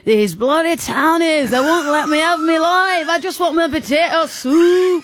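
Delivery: high, hammed-up Northern English farmer rant; indignant, high-pitched Northern English complaint; Irish gone Northern English. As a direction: wail it in a botched Irish, Northern English accent. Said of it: "That's Northern England".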